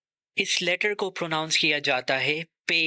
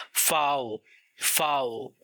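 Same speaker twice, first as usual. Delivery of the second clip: normal talking voice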